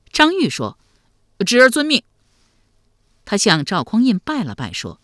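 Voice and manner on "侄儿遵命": put-on high, loud boyish reply; youngster's ringing assent; obedient young nephew. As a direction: accept smartly like a keen lad. Boy accepts emperor's order.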